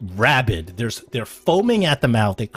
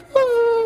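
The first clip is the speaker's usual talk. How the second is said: high, drawn-out whimper; dog-like whine; 3 dB louder than usual. Acted. Clubbed prisoner acting like a dog, high whine. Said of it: "he starts whining".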